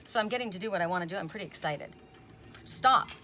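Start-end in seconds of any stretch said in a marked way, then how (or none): 2.6-3.1 sharp, loud stop; a loud order to stop; emphatic stop. Real, not acted. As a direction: call it out like a command. Reading the stop card aloud.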